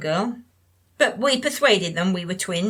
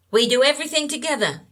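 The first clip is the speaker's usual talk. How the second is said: imitating the jealous friend; 6 semitones higher, faster than usual. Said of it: "she kept saying".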